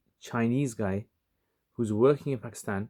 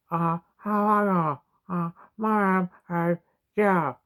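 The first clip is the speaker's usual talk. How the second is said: imitating a Chinese man's broken English, pitched much higher; broken Chinese-accented English; 8 semitones higher, 5 dB louder, much slower than usual. Narrator mimics the Chinese convert greeting him, in a high Chinese accent. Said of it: "really broken English".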